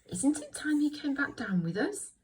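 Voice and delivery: high little voice; tiny high-pitched storybook voice; Little Bear. Shown as a demonstration. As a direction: pipe up in a small, high character voice. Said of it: "Little Bear, little voice".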